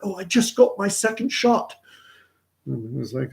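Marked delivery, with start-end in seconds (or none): 0.0-1.7 much higher, chirpy mock woman's voice; imitating Anu. Happy tennis partner bragging about her shot, mock high voice. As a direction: play her bubbly and pleased. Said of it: "And she goes".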